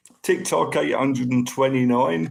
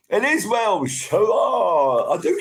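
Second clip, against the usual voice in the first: shouted hurrah of triumph; excited cheer; 8 semitones higher than usual. Real, not acted. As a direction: celebrate getting the name right. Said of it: "cheering at getting name right".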